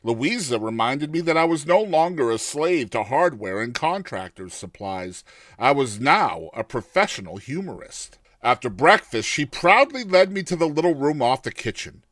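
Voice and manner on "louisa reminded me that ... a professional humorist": usual speaking voice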